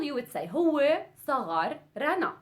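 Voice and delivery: normal talking voice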